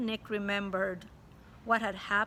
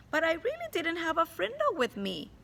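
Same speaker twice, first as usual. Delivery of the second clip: put-on higher, innocent boy voice; cheeky boy; 8 semitones higher than usual. Boy insists to his teacher, higher and innocent. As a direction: play the clever rascal denying it. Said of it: "looked her in the eye and said".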